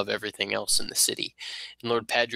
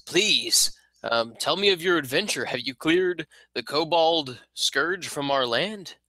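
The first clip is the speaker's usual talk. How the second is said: a hint of an eager nobleman, higher; asking politely, a bit raised; lightly voiced lord; 6 semitones higher than usual. Lord asks heroes about kobolds, lightly higher.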